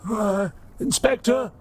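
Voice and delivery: put-on high, hesitant old robot priest; old broken robot. Old robot asking if inspector is there, high and hesitant. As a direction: call out uncertainly in a high, frail robot voice.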